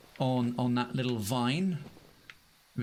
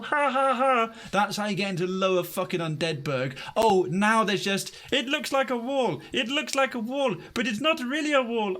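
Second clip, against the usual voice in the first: full rant mode; 8 semitones higher than usual. Real, not acted. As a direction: rant at the game in disbelief. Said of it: "I am in full rant mode".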